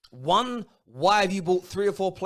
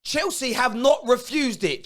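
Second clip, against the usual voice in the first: ordinary delivery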